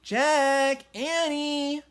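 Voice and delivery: high, loud call from a distance. A narrator's reading. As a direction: call the kids again. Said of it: "called their mom again".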